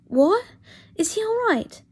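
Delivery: sudden high, loud What; Harry startled, asking if Draco is all right; alarmed. Acted. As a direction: jolt of worry for Draco.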